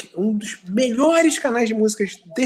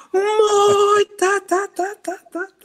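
high wailing fake sob; mock crying wail; 13 semitones higher, much slower than usual. Mock crying shout, old age.

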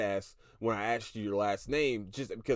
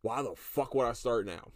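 normal talking voice

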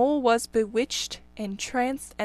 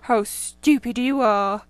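slow, loud grumbling rabbit; 5 dB louder, slower than usual. Acted. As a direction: scold a friend, slowly. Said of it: "they all started grumbling".

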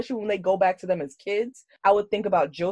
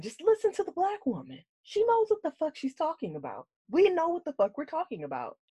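high-pitched, softer exasperated plea; exasperated insistence; 9 semitones higher, 6 dB quieter than usual. Real, not acted. Exasperated plea about the show.

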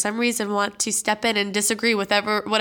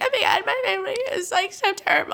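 high, mock-tearful wail; hammed-up weepy imitation; crying; 11 semitones higher, 3 dB louder than usual. Emotional [name] lamenting the family fight, mock crying. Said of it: "will cry".

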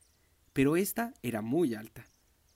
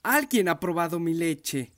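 loud but less than papa bear, raised pitch; a grumbling mother bear, a bit less loud; mama bear growling; 4 semitones higher, 7 dB louder than usual. A narrator's reading. Mama bear complaining about her milk, a loud grumble. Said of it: "gruñó un poco menos fuerte".

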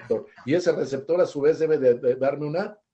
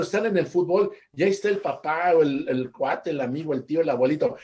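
ordinary talk

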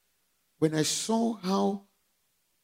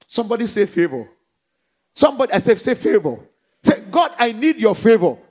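shouted call-and-response; preacher demands the congregation say favor; loud call to respond; 8 dB louder than usual. Real, not acted. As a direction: rouse the church to shout back.

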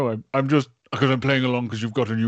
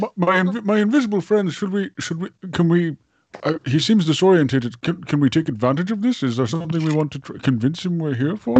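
higher, halting, fussy character voice; conspiratorial character; 5 semitones higher than usual. Acted. Character asking invisible friends about exploiting a disoriented man, higher halting voice. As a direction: appeal to your companions in a lifted, stammering voice.

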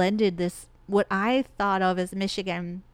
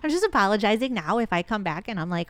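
ordinary talk, nothing marked

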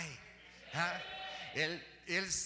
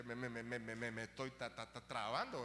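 stammering; 6 semitones lower, 4 dB quieter than usual. Real, not acted. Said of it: "todavía estoy temblando".

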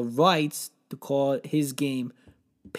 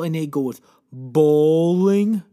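slow, loud and incredulous; outraged disbelief; 6 dB louder than usual. Acted. Comedian ranting about bowling, slow outraged disbelief. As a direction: drag it out in disgust.

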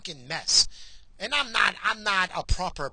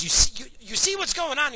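ordinary delivery

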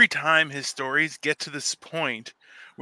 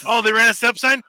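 raised, rising exasperation; mock exasperated rant; 5 semitones higher, 6 dB louder than usual. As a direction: wave it off loudly, fed up. Acts out letting a driver off.

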